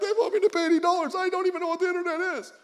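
high, fast, whiny mock sob voice; imitating crying teen. Teen girl crying to pastor about the hotel bill, high fast whine. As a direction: play the upset teenage girl complaining through tears, high and fast. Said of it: "sitting there crying".

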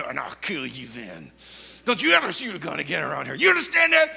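biker threatening the shooter, menace to shout; building threat. Retold or imitated.

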